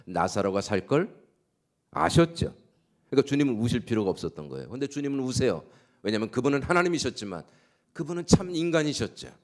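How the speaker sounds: high, loud, fervent preaching; preaching at full force. Acted. Pastor proclaiming good news to the congregation, fervent loud preaching.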